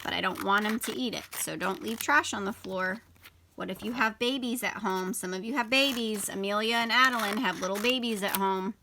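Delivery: normal talking voice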